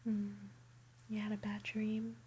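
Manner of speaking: ordinary delivery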